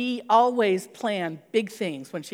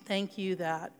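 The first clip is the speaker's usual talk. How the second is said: prayer; 3 semitones lower, 5 dB quieter than usual. Acted. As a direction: pray quietly over the congregation. Leads closing prayer.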